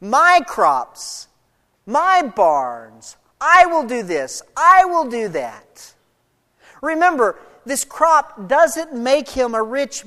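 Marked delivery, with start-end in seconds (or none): 0.0-5.9 short separate phrases, each led by a hard-hit "my" or "I"; stress on MY and I. Shown as a demonstration. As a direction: punch "my" and "I" loudly at each start. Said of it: "notice the emphasis on "I" and "my".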